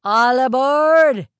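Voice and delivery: very loud, long-drawn high call; captain calling out. A narrator's reading. Captain calling passengers to board, hollering. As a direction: holler it slowly across the dock.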